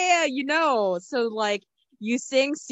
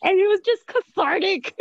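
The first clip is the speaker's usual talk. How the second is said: high-pitched, breaking into laughter; laughing; 13 semitones higher than usual. Real, not acted. Co-host recalling the best show comment, laughing. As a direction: crack up as you get the words out. Cracking up over a favourite comment.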